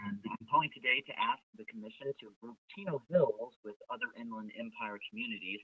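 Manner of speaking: faint, distant voice on the call; speaking too far from the phone; phone held away. Real, not acted. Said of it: "Could you speak closer to the phone".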